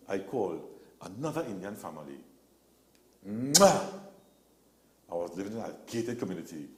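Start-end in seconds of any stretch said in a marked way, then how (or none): 3.3-3.8 kiss sound. Retold or imitated. Mock kiss after finding house.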